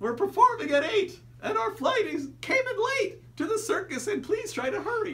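high, loud silly clown. Acted. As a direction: squeal it like a frantic circus clown. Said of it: "Was that a good clown voice?".

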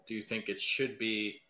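normal talking voice

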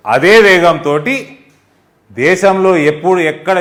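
slow and loud, each word hammered; a proud, forceful rally punch; emphatic loud. Real, not acted. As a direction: slam the phrase home with force. Praising Modi's speed, emphatic.

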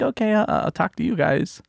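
bow out gently in a high voice; a hint of high grandma voice; imitated understanding grandma. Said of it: "She's like".

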